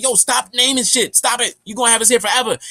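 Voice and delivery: high, loud, exasperated nagging; mock scolding. Woman scolding the man naming animals, high and loud. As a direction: scold him to quit naming things.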